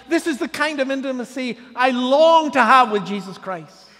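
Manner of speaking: loud, rising pulpit delivery, each line hammered; full-force preaching. Acted. Pastor preaching Peter's failure to the congregation, loud and rising. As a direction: preach it out hard over the congregation.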